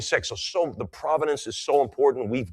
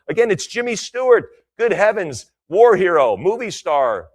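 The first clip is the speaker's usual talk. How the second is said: enthusiastic; 7 semitones higher, 6 dB louder than usual. Real, not acted. Gushing about Jimmy Stewart.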